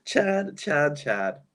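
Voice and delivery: giggling through the name; laughing. Real, not acted. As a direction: say it while cracking up.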